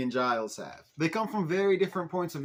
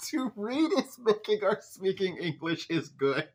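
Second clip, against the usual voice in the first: laughing quote; 5 semitones higher than usual. Real, not acted. Rereads garbled line, cracking up.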